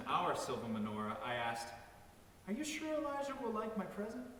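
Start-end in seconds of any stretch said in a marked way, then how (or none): 2.5-4.3 put-on child's question. A narrator's reading. Child asking anxious question.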